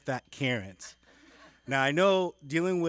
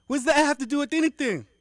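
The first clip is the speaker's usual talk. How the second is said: much higher, loud outraged yelp; indignant outburst; 14 semitones higher, 10 dB louder, faster than usual. Retold or imitated. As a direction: blurt it out in disbelief. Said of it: "offended caller".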